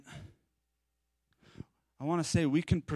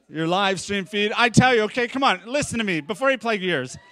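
laughing and louder while pleading; 3 semitones higher, 6 dB louder than usual. Real, not acted. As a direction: chuckle through the warning, pushing on. Laughing through a spoiler warning.

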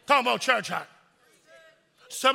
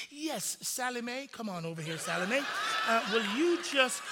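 mock sweet manager; 8 dB quieter than usual. Manager calling waitress voiced.

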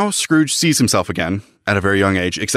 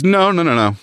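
frantic refusal; 8 semitones higher than usual. Acted. Scrooge refusing, acted out.

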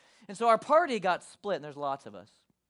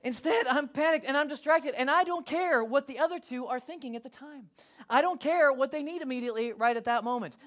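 ordinary talk

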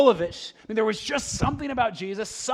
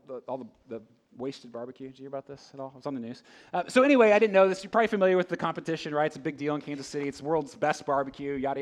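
normal talking voice

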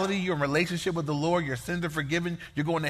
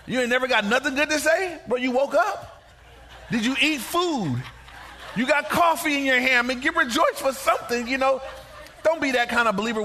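animated scolding; 6 semitones higher than usual. Real, not acted. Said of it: "preacher scolds the gloomy believer".

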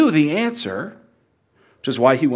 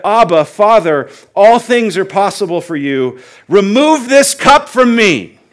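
loud pleading prayer; 5 semitones higher, 9 dB louder than usual. Acted. Jesus pleading with the Father, loud anguished prayer. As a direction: raise the voice, begging the Father.

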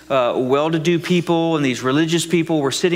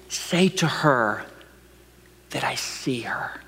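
slow, soft whisper of God; tender hushed whisper from above; God's whisper; 3 semitones lower, 5 dB quieter, slower than usual. Pretend. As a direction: whisper it like a voice in your ear. God speaking into your ear.

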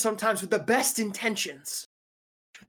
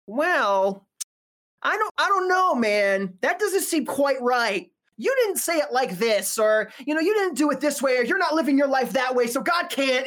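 doubting religious naysayer; 3 semitones higher than usual. Acted. Pastor mimics people who doubt a healing, mock doubtful objections. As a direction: mimic a sceptic picking holes. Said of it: "that are always like".

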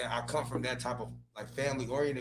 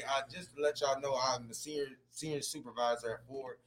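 ordinary delivery

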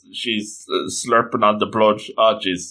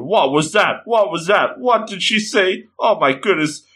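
rising mock panic; panicked thoughts; 6 semitones higher than usual. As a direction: repeat it higher, lightly hammed-up alarm. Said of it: "Kaneki's just thinking".

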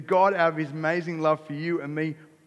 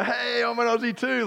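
excited greeting; 7 semitones higher, 3 dB louder than usual. Acted. Australian greets another Australian overseas, excited. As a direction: hail a countryman with mock over-eagerness.

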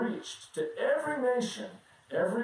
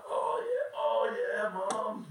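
put-on sleepy kid voice; 4 semitones higher, slower than usual. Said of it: "scene: kid pretends to wake".

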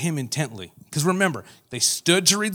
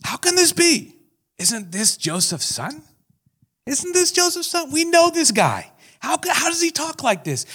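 much higher, mock-amazed townsperson; incredulous townsfolk; 11 semitones higher than usual. Hometown crowd doubting Jesus, high mock disbelief. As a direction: play the stunned hometown crowd. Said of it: "And then they say this".

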